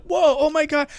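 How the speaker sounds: high-pitched retold alarm; mock freak-out. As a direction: act out a kid's startled fright. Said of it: "freaking out".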